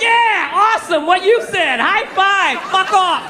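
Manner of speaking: high, drawn-out mock enthusiasm; fake agreement. Boyfriend shooing girlfriend away from the game, fake agreement. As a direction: agree hollowly just to get rid of them. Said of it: "You just agree with them".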